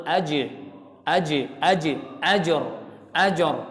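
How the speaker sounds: "aj" and "ajor" with the jim bounced in a short echo; bouncing jim. Shown as a demonstration. Teacher showing students the bouncing jim. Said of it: "jim qalqalah boleh mantul".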